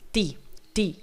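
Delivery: T pushed out with a burst of air; forced T. Shown as a demonstration. Teacher shows learners the forced T. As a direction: force the T with a strong release. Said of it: "Os seus T's têm que ser forçado".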